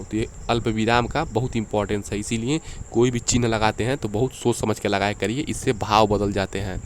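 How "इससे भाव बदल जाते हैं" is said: usual speaking voice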